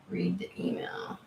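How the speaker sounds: unvoiced whisper, read off the screen; whispered aside. Real, not acted. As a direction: murmur the viewer's request under your breath. Reading a viewer's chat aloud.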